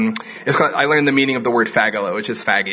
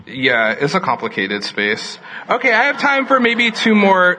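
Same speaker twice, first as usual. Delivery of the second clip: ordinary delivery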